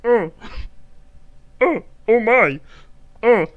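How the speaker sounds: high, loud mock climax with moans; Jordan Peterson. Comedian spoofs a psychologist climaxing, Jordan Peterson impression. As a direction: build from short high grunts to long shouted wails. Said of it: "This is what Jordan Peterson sounds like".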